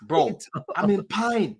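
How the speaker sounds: slow, drawn-out mock Nigerian-English accent; mock impression of the pundit's mixed accent; imitating his accent. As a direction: stretch it out like the pundit does, lightly mocking. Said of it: "That's how he talks".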